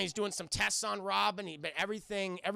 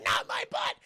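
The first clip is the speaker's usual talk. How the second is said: very high, loud scared yelp; hammed-up squeal of protest; mock fright; 5 dB louder than usual. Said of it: "he's scared to death".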